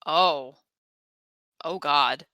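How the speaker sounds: usual speaking voice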